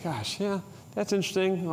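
soft, awkward "that's interesting"; quietly hint it is really bad; hushed, uneasy polite reaction; veiled disapproval. Shown as a demonstration. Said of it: "like, a really bad thing".

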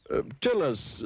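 high, quizzing elephant voice; clever elephant. A narrator's reading. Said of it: "said the clever elephant".

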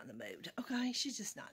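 soft whisper. Real, not acted. Telling viewers the cat is sleepy, whispered. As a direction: whisper so the cat can rest.

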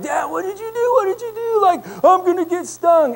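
put-on a frightened boy sure he'll be stung; panicked kid. Son yelling at his dad about the bee: high panicked cry. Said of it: "goes back to freaking out".

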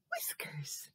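high breathy whisper. Acted. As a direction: whisper the word. Mysterious whisper to kids.

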